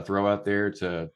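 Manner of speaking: ordinary talk, nothing marked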